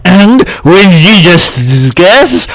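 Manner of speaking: put-on old fly listing food, slow and buzzing. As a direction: draw it out like a droning fly. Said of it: "God, I hate this voice".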